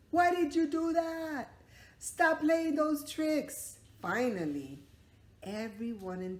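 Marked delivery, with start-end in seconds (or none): 0.1-3.8 high, loud furious yell; angry shout. Acted. Fallen man yelling at the dad. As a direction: yell at the trickster.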